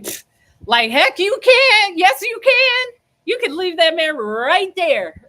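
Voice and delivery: rising to a loud, high, emphatic yell; mock-shout her into leaving, building from a scoff; loud retold urging. Urging girl to leave fiance.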